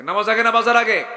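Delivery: loud questioning with a laugh. Real, not acted. Preacher asking whether prayer or shopping comes first. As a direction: challenge the crowd loudly.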